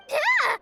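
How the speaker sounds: high startled cry; startled yelp. Acted. Watcher caught by surprise, startled yelp. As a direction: yelp as if suddenly grabbed.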